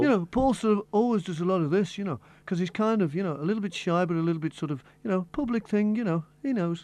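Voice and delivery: shy but public-facing Beatle voice; [name]. Shown as a demonstration. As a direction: do a chatty, slightly bashful Liverpool musician. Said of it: "how do you talk, [name]?".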